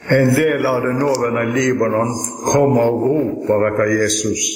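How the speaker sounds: ordinary talk, nothing marked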